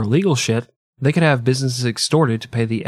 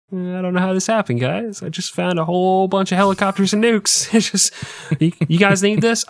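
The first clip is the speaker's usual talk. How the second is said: high, fast, feigned innocence; fake-innocent Dudayev; 8 semitones higher than usual. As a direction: play dumb about the nukes, brightly. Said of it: "voicing Dudayev faking innocence".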